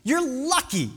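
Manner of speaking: high-pitched, oddly jolly encouragement; jolly him along incongruously; mock cheering-up. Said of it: "Like this, this weird".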